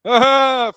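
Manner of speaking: loud, high drawn-out "ah"; exaggerated open-mouthed holler; mock gobbling cry. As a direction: act out scoffing a handful, loudly. Acting lad gobbling the handful.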